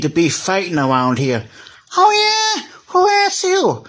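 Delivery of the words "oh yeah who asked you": very high, squeaky shouted taunt; squeaky toy shouting. Pretend. Toy shouting back at the peacemaker, squeaky and high. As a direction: yell it up high like a tiny angry toy. Toys quarrel in pretend play.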